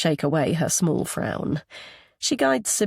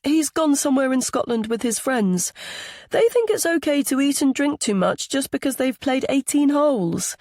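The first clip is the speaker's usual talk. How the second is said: put-on high, chattering child's voice; little boy; 8 semitones higher, 3 dB louder than usual. A narrator's reading. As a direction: explain it like a small boy with news. Young boy talks about grown-ups.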